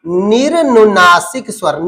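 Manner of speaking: निर्नुनासिक स्वर spelled out slowly, each syllable clear; slow for repeating. Shown as a demonstration. Teacher dictating the new term to students, slow. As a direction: lay out निर्नुनासिक स्वर slowly for the class to copy. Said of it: "मेरे साथ बोलते रहना".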